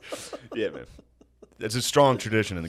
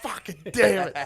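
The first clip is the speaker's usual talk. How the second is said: high, slow mock cursing; lightly voiced angry dad; imitating dad cursing; 10 semitones higher than usual. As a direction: play the fuming dad, hammed up.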